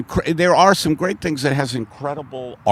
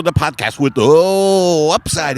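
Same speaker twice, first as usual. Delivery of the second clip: mock announcer; 6 semitones higher, 4 dB louder than usual. Host greeting listeners, hammed-up announcer. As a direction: open the show like a grand emcee.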